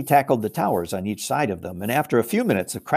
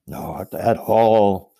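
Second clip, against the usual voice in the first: slow, courteous, lightly voiced giant; polite giant; 3 semitones lower, 4 dB louder, much slower than usual. Giant politely thanks Lucy for the handkerchief, slow and courteous. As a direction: drawl the thanks kindly. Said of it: "said the giant politely".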